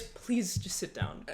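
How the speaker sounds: soft, slow plea; restrained anger. Acted. Angriest self pleading with a boyfriend, restrained and quiet. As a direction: say it low and slowly, holding it in.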